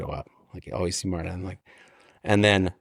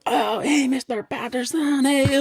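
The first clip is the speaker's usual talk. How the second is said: much higher, slow, sing-song hello; mock cheerful greeting; slower than usual. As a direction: greet him warmly in a put-on voice. Martin greeting him.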